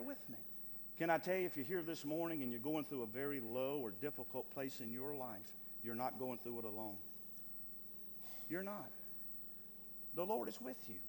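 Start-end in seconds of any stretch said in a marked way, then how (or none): 2.5-11.0 soft, low reassurance; tender comfort. Acted. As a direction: lower the voice to console. Comforting the suffering listeners.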